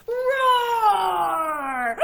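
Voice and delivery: very loud high roar; big dinosaur roar. Acted. Big dinosaur bursts into the room, loud roar. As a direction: let out a huge roar.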